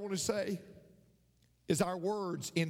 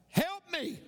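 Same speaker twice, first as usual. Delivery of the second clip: high, short call for help; acted scream for help; 9 semitones higher than usual. Boy hanging from a limb in the mountains, acted scream. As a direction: cry out high, acting a frightened child. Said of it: "he screamed out".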